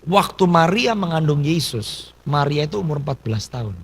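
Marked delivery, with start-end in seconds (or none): none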